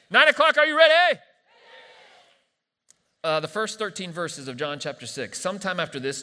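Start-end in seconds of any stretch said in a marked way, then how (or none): 0.1-1.2 full high shout to the crowd; hollered hype call, at full volume; rousing shout. Real, not acted. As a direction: fire up a sleepy congregation. Calls again to nine o'clock congregation.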